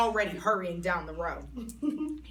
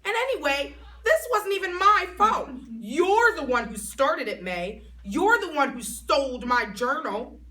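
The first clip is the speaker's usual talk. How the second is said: high, loud protest; indignant arguing; 7 semitones higher, 6 dB louder than usual. Acted. Junie defending herself to May, loud and indignant. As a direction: fight back hard.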